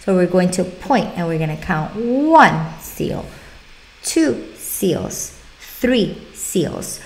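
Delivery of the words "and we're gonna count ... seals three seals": slow count, S of "seals" held out; accentuated S. Shown as a demonstration. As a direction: count each one and push the S in "seals". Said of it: "put the S at the end very, very accentuated".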